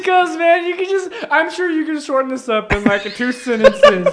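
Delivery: high voice through laughter; laughing while talking. Real, not acted. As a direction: tease him while cracking up.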